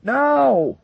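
very high, loud single yell. Acted. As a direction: bellow it. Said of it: "Wilkinson yelled".

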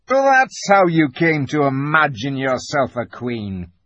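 high, loud scornful beaver voice; indignant beaver. A narrator's reading. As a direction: jeer as an outspoken beaver. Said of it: "said Mister Beaver".

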